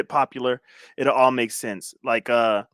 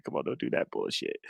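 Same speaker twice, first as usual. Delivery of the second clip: breathy, much softer whisper at the screen; whispered grumble; 14 dB quieter than usual. Real, not acted. Streamer whispering at the video settings, annoyed. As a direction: hiss it under your breath at the laptop.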